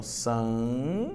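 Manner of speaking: "san" drawn out slowly with a rising end; syllable demonstration. Shown as a demonstration. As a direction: stretch "san" as a lone syllable. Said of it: "Separa as sílabas comigo".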